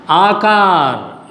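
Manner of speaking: आकार said slowly with both आ vowels held; long आ sound. Shown as a demonstration. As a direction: stretch out आकार for learners. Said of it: "मात्रा का उच्चारण". (आकार को खींचकर, धीरे बोलें)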